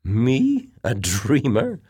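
high, rising, incredulous questions; surprised. A narrator's reading. Officer called a dreamer by his colleague, surprised. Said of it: "eyebrows arched in surprise".

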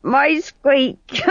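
put-on high squeaky drunken voice; drunk fool squeak. The fool correcting himself at the bar, high squeak. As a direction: squeak it like a tipsy clown.

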